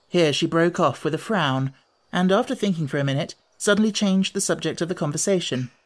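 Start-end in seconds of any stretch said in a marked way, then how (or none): none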